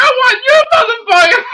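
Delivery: very high, loud mock yell; retold furious shout. Teller turning the robbery on the robber, furious shout. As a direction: scream the demand at the top of your voice.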